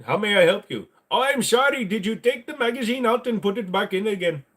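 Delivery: hammed-up Indian helpline agent; mock tech support agent. Support agent Beljit answering a gun owner's call, mock Indian accent.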